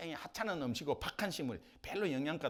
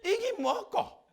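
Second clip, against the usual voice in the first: high, loud scornful Gyeongsang jeer; mock the paltry portion; 9 dB louder, much slower than usual. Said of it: "경상도 말로 꼴란 이거 가지고".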